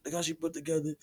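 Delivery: high-pitched hype of other men; admiring guys. Retold or imitated. Imitating guys admiring the woman, exaggerated hype.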